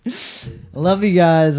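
loud, high burst of love you guys; aggressive shout. Real, not acted. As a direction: blurt it out too hard. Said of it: "way more aggressive than I thought".

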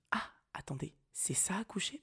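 unvoiced, panicky whisper; frightened whisper. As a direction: whisper in dawning dread. Panicked inner thought.

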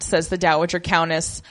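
usual speaking voice

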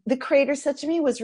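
normal talking voice

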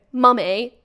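lightly posh English "Mummy". Acted. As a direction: call "Mummy" a bit posh. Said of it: "she doesn't say it in a posh way".

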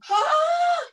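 high, loud "huh?"; surprised. Acted. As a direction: shout the question, startled. Mock confusion.

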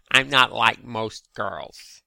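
put-on slow, drawn-out Valley Girl accent. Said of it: "fucking Valley Girl accent".

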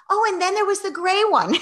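put-on high, gushing child's voice; whiny kid. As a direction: rave on like an eager kid. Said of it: "very revved up, but also very whiny".

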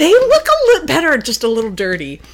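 high, fast and dreamy; swooning. Real, not acted. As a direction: gush over him breathlessly. Swooning over the dusty hero.

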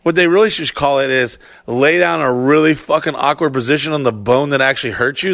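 usual speaking voice

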